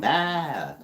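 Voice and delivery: high, loud mock bleat; sheep bleat. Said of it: "they make they sound".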